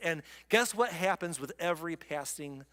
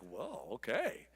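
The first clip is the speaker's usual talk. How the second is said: 1 soft stunned whoa; 6 semitones lower, 8 dB quieter, slower than usual. Retold or imitated. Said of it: "And Jeremiah's like".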